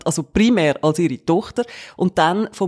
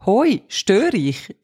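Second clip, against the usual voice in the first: slow, drawn-out "Hoi, störe ich"; her phone greeting; much slower than usual. Retold or imitated. She greets someone on the phone, slow and hesitant. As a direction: stretch it out hesitantly. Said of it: "dann sage ich als erstes immer Standard".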